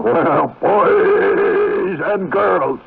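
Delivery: high, very slow jolly Santa; hammed-up Santa greeting. Shown as a demonstration. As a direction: stretch it out like Santa greeting kids. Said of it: "That's the way Santa talks".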